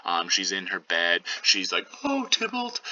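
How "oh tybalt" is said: very high, whimpering fake weeping; mock blubbering. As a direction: wail it in a tiny high voice, hammed up. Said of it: "she's blubbering and weeping".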